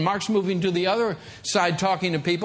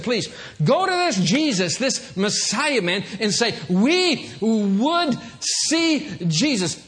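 earnest plea; 2 semitones higher, slower than usual. Acted. Greeks' request acted slowly.

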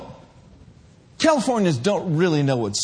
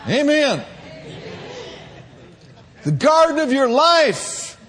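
rising, louder, higher preacher's shout; fiery pulpit climax; preaching at full force; 8 semitones higher, 4 dB louder than usual. Acted. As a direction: build the sermon to a shouting peak.

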